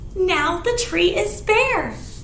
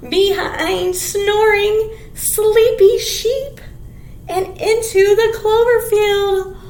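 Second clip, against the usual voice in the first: slow, louder storybook reading; reading to children; 2 semitones higher, 5 dB louder than usual. Acted. Teacher reading the picture book to kids. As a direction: read each phrase out with relish.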